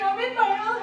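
normal talking voice

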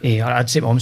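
normal talking voice

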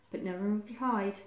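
plain reading voice

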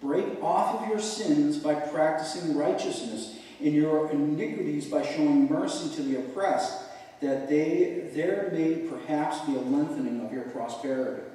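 measured, solemn Bible reading; scripture reading. Acted. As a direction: read the verse like it's from the pulpit. Reads scripture aloud.

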